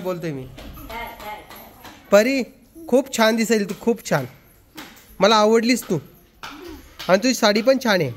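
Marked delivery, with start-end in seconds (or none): none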